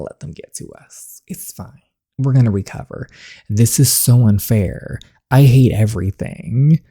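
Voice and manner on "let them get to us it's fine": whispered. Real, not acted. Soothing herself after a fight.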